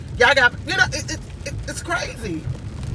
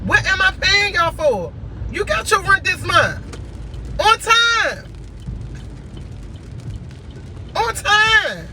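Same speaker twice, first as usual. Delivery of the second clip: high-pitched, exasperated demand; indignant rant; 9 semitones higher than usual. Real, not acted. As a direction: demand it like a fed-up tenant, voice pitched way up. Venting at landlord who won't call back.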